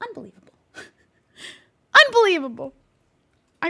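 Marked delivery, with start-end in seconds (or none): 0.1-0.5 muttered softly in disbelief; under breath. Real, not acted. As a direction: say it to yourself. Can't find the hider.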